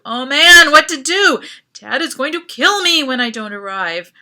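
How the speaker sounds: loud, frantic worry; panicked. A narrator's reading. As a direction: spiral into panic. Panic over missed train.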